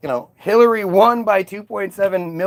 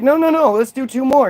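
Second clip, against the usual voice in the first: mock Kamala; 6 semitones higher than usual. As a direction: squeak it out, mocking her eagerness. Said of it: "And she was like".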